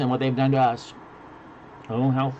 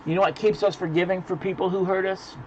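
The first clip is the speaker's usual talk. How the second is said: ordinary delivery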